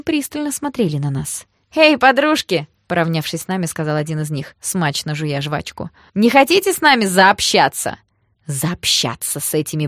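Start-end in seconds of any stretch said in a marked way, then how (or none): 1.7-2.7 loud, higher-pitched street hail; calling out. Acted. Thug hails the passing friends, loud and cocky. 6.1-8.0 loud, higher-pitched leering invitation; brash come-on. Acted. Thug invites strangers to hang out, loud and pushy. Thug accosts two friends.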